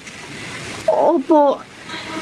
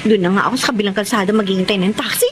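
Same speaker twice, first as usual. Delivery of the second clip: hurried; 2 semitones lower, faster than usual. Acted. Late, rushing to cross road.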